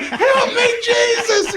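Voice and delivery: very high wailed plea; mock screaming. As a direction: shriek for divine help, imitating her. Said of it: "then she starts screaming".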